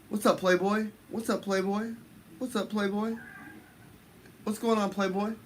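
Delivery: high, lilting what's up catchphrase; high sing-song greeting. Acted. Streamer greeting chat in a high sing-song voice.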